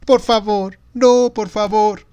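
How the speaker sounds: put-on high, loud child's wail; whimpering plea. A narrator's reading. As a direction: beg desperately, drawing out the no. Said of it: "gimió". (súplica gimoteante de niño)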